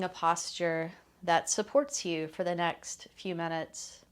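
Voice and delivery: calm, unhurried meditation voice with long pauses; guided meditation. Real, not acted. Teacher settling listeners into a loving kindness practice, guided meditation. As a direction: ease listeners gently into stillness, leaving space between phrases.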